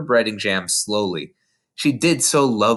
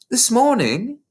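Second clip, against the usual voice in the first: put-on lightly raised little girl voice; Matilda's voice; 12 semitones higher, 3 dB louder, slower than usual. A narrator's reading. Narrator voicing young Matilda to her teacher, small girl voice. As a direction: voice a bright, earnest young girl.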